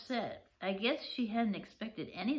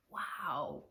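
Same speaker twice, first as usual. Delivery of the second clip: usual speaking voice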